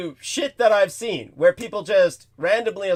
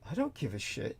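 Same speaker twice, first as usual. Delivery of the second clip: low, much quieter deadpan aside; dismissive thought; 9 semitones lower, 16 dB quieter than usual. Retold or imitated. Passenger's private reaction to the crying driver, dismissive thought. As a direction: drop right down as if thinking it privately. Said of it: "And I'm thinking".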